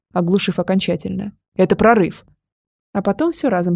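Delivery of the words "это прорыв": urgent, mildly barked warning. A narrator's reading. Dragon warning the woman of a breach, barking. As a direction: bark it out, held in check. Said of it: "рявкнул дракон мне на ухо".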